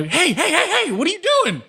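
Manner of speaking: high, yelling bandit voice; a high-pitched enemy shouting across the chasm; shouting guard. Acted. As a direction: call out high and alarmed from hiding.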